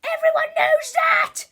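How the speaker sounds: high, full-volume rant; furious teacher's screech; screamed. A narrator's reading. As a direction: scream it at the class. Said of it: "screamed Miss Ribble".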